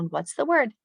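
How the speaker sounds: high-pitched classroom prompt; teacher to class. Shown as a demonstration. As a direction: prompt young pupils for the answer. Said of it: "So I might say".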